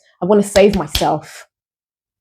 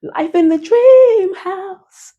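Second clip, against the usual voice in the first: dreamy; 13 semitones higher, much slower than usual. Pretend. Dreamy mock of her own style.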